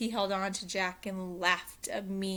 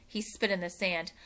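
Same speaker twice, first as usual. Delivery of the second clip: ordinary delivery, nothing marked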